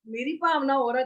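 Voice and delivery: ordinary talk, nothing marked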